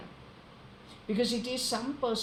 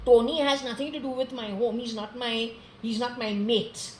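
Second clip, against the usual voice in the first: ordinary delivery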